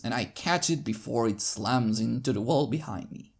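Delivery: ordinary delivery, nothing marked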